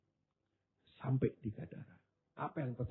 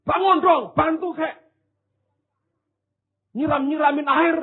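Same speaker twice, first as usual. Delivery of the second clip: mock complaint; 11 semitones higher, 4 dB louder than usual. Disciples complaining at sleeping Jesus.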